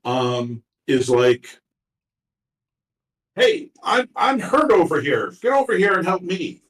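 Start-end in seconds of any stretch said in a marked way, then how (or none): 3.4-6.6 high-pitched, insistent call for help; acted pushy complaint, pitched well up; demanding injured passenger. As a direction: demand attention in a raised pitch. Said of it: "who demanded your attention".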